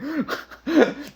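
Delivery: a bright high laugh breaking in. Real, not acted. Pastor laughing as his brother starts crying, high laugh.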